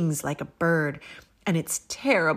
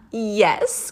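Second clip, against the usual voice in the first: louder glad cry; cried yes; 3 semitones higher, 7 dB louder than usual. A narrator's reading. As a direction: call out yes with delight. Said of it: "cried the witch".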